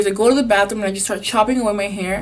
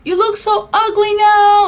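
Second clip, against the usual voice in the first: high, loud and slow scolding mother; mother aghast at the haircut; horrified mom; 12 semitones higher, 5 dB louder, slower than usual. Retold or imitated. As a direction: wail at the kid over her ruined hair. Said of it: "she's like".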